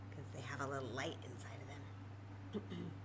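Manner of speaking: hushed spooky storybook whisper. A narrator's reading. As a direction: breathe it like a secret in the dark. Whispered spooky book line.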